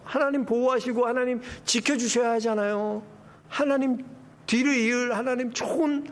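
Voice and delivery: normal talking voice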